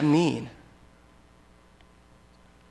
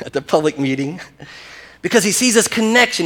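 ordinary delivery

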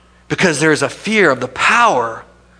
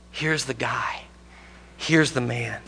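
hushed Pilate; 4 semitones lower, 11 dB quieter, slower than usual. Acted.

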